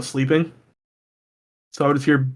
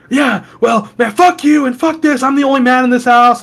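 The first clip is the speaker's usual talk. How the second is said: higher, mock angry aunt; mock aunt yelling; 7 semitones higher than usual. Imitating his tweaker aunt yelling at him, higher mock anger. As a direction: ham up the furious aunt.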